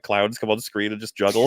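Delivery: normal talking voice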